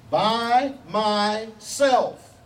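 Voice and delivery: BY MYSELF drawn out very slowly, each word stressed; emphatic. Acted. Preacher quoting God to correct his own joke, emphatic and very slow. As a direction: give "by myself" three slow, weighty beats. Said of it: "is emphatic over and over".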